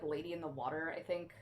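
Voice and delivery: ordinary talk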